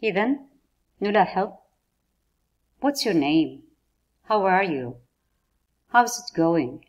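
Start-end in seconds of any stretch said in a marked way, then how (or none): 2.8-6.8 What's your name, How are you, How's it going each fall at the end; نغمة هابطة في آخر السؤال; falling question tune. Shown as a demonstration. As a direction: let every question drop in pitch on its last word. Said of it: "ينخفض الصوت في نهاية السؤال".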